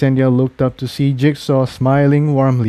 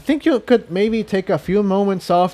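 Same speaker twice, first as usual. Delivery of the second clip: higher, lightly voiced mare; Incendia's voice; 8 semitones higher than usual. A narrator's reading. Incendia's voice in story.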